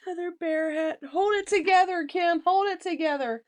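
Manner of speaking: high, loud, tearful self-coaching; fighting back tears. Real, not acted. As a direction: pull yourself together while choked up. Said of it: "I'm, like, seriously crying".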